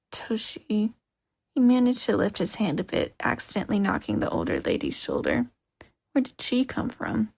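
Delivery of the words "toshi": faint breathy whisper. Acted. Dazed hurt boy whispering his friend's name. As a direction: barely get the name out.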